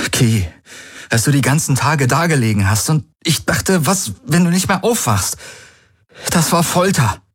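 lifted voice, pained and urgent; Galen, anguished. Acted. Galen tells Key her coma was torture, anguished. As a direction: pour out the days of fear. Galen pours out his fear.

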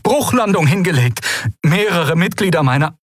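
high, urgent crash report; high-pitched emergency caller; distress caller. A narrator's reading. Stricken captain reports crash by radio.

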